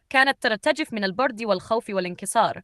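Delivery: ordinary delivery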